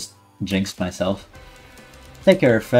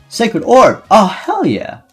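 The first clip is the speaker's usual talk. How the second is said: high, loud delighted cheer; thrilled shout; 12 semitones higher, 7 dB louder, slower than usual. Real, not acted. Player finding Sacred Orb, high thrilled shout.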